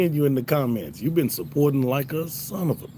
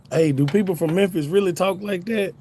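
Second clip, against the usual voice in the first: higher-pitched retold disbelief; imitating incredulous question; 7 semitones higher than usual. Cousin asking about Memphis talk, imitated incredulity. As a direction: act out a puzzled relative asking.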